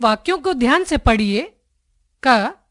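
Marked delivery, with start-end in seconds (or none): none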